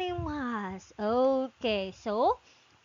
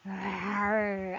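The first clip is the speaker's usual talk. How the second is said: tiger roar; 5 semitones lower than usual. Pretend. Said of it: "So the tiger says".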